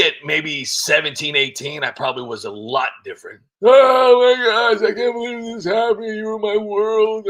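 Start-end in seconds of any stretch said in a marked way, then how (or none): 3.6-7.3 high, loud, slow heartbroken whine; lovesick teenager. Retold or imitated. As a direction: wail like a dumped teen. Mock lovesick teen self.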